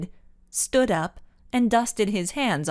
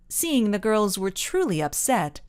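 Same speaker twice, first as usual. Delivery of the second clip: ordinary delivery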